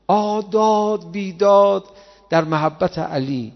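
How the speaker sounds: a wailing cry of wonder, drawn out; loud slow exclamation. Real, not acted. Preacher exclaims in awe.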